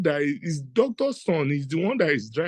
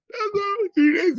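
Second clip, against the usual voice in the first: excited; 13 semitones higher than usual. Retold or imitated. Dramatic reveal, cry and shout.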